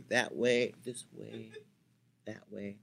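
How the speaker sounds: fades to near nothing on that way; fading mutter; trailing off. Shown as a demonstration. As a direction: let the words die away. Demonstrating ways, trailing off.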